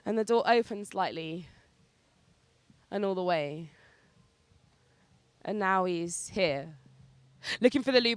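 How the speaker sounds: rhythmic spoken-word narration with measured pauses; poetry-slam storytelling; spoken-word poem. Acted. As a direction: perform it as a live poem, driving through the long lines and landing the endings.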